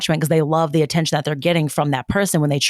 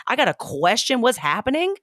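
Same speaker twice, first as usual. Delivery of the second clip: indignant sass; 8 semitones higher than usual. Retold or imitated. Acted indignant self-love speech.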